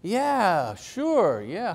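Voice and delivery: drawn-out, swooping yeah-sure; mock agreement. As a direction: play people going along with exaggerated agreement. Voicing people's sceptical reaction.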